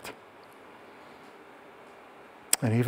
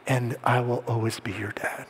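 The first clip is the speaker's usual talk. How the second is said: whispered, breathy and hushed; tender whisper; 5 dB quieter than usual. Retold or imitated. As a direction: whisper it like a father soothing his son. End of lullaby to son.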